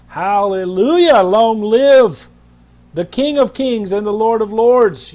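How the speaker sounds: loud, ringing proclamation; Long live belted out to the congregation; fervent shout. Real, not acted. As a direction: lift it up like a royal herald. Preacher exalting, building to a cry.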